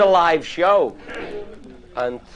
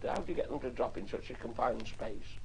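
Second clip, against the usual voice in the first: softly imitated formal interviewer; low-key, much softer mock-formal questioning; 4 semitones lower, 15 dB quieter than usual. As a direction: put a quiet, proper question like a broadcaster. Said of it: "I thought he was being very formal".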